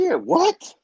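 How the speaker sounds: high startled what; sudden high surprise; surprised. Real, not acted. Surprised at show mention.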